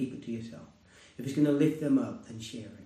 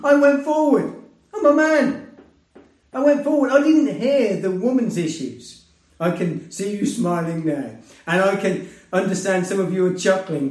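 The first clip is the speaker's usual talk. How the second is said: excited; 6 semitones higher, 8 dB louder than usual. Real, not acted. Laughing at his own embarrassing story.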